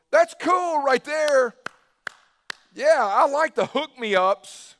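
mock fan. Fringe fans voiced admiringly.

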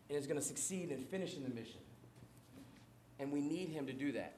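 hushed solemn aside. Real, not acted. Drops voice for solemn point.

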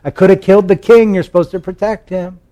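high-pitched shouted reproach; calling out a taunt. Acted. David scolding the commander from afar, high called-out taunt. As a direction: scold someone across a distance.

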